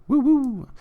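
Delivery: high, loud celebratory whooping; high woo-woo whoop. Real, not acted. Streamer cheering as his match finally starts, high whoop.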